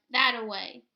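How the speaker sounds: loud barked directions. A narrator's reading. Stanley leading the dogs to the boat, loud bark. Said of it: "he barked".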